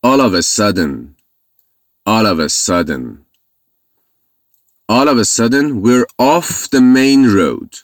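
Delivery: very slow, deliberate English phrase; slow model reading. Shown as a demonstration. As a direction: drill it slowly, word by word. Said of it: "یهویی".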